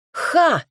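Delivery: sharp high exhaled laugh-shout; scornful ha. A narrator's reading. Heroine scoffs at the lord's offer, sharp exhaled shout. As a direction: blurt a scornful ha. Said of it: "выкрикнула я на выдохе".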